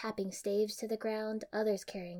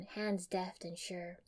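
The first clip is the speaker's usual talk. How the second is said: usual speaking voice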